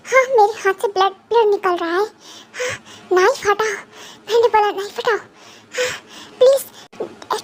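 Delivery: a wounded captive cries and pleads for mercy; tearful pained plea. Acted. Captive begs King Thang to pull the knife out, sobbing.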